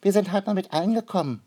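high-pitched, shy ant voice; shy little ant. A narrator's reading. First ant explains itself to Noah, shy and high. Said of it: "sagte die erste Waldameise schüchtern".

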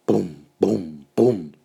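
lautes kindliches Bum bum bum; Paukenschläge nachgemacht. Acted. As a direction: trommeln mit der Stimme. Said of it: "macht er". (Kind macht die Pauke nach: laut „Bum, bum, bum“)

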